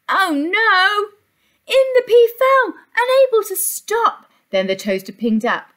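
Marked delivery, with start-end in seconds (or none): 0.1-4.3 high, loud alarmed storytelling; dramatic alarm. A narrator's reading. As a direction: sound the alarm for the pea. Dramatic fall into toaster.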